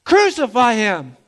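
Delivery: loud, slow, heavy shouted crucify; crowd shouting crucify. Retold or imitated. Preacher voicing the mob turning on Jesus, loud slow shout. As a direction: hammer each crucify like an angry mob. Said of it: "they shouted".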